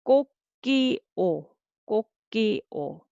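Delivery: 꼭지오 split into three separate beats; syllable by syllable. Shown as a demonstration. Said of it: "say syllable by syllable".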